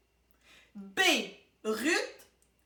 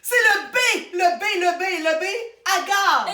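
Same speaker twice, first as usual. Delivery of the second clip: loud, high, repeated answer; breathless rush to answer first; eager blurting; 3 semitones higher, 6 dB louder than usual. Real, not acted. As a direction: jump in like a contestant who knows it.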